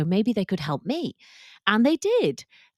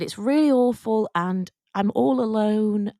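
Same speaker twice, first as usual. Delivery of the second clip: emotional; 3 semitones higher, 4 dB louder than usual. Real, not acted. Retelling the ghost's words, moved, higher and louder. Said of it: "I'm getting emotional talking about it".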